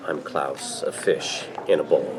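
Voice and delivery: deep, resonant and low. Acted. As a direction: let the voice resonate low in the chest. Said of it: "Your voice is so resonant".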